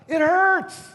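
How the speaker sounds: loud, high outburst; emphatic exclamation. Real, not acted. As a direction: cry it out as utterly obvious. Preacher exclaims the obvious reason.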